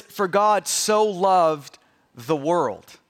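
slow, measured and weighty; solemn verse quotation. Shown as a demonstration. Pastor corrects the expected version with the true verse, solemn and slow. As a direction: let each word land slowly.

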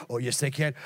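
usual speaking voice

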